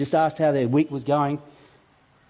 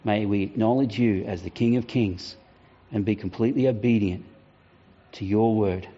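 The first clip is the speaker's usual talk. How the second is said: closing prayer; 4 semitones lower than usual. Acted. Pastor leading the congregation in a closing prayer.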